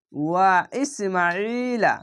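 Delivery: ওয়া ইসমাইলা slow, long vowels drawn out; elongated recitation. Shown as a demonstration. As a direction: chant ওয়া ইসমাইলা stretching the alif. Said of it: "খাড় জাবারে এক আলিফ টেনে পড়বেন". (টেনে পড়া ওয়া ইসমাইলা)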